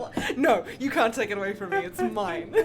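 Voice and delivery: loud laughing protest; cracking up while insisting. Real, not acted.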